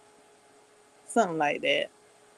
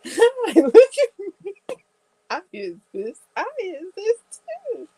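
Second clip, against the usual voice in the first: cute cards' voice; 12 semitones higher, 3 dB louder than usual. Pretend. Collectible cards calling out to buyer, squeaky and cute. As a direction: squeak like a toy begging to be picked. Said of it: "And now here comes".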